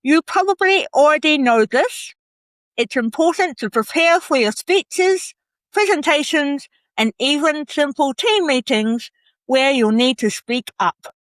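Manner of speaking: reading with a pen held in the mouth. Shown as a demonstration. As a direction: talk around a pen between the lips. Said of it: "So I've got my pen in my mouth".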